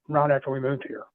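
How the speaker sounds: ordinary talk, nothing marked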